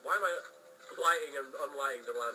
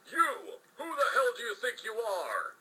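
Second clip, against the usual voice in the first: angry yell; 8 semitones higher, 4 dB louder than usual. Acted. Officer yelling at Jack.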